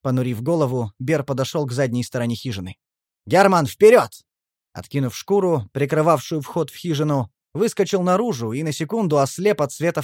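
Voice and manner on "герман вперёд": loud, high shout to charge; explosive rallying cry; battle command. A narrator's reading. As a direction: bark the order to attack. Orders the charge.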